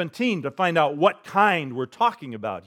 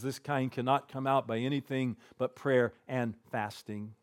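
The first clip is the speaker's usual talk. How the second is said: quiet solemn quote; 3 semitones lower, 11 dB quieter than usual. Retold or imitated. Jesus answering his disciples, quiet and solemn. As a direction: let it sink in softly. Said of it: "he says".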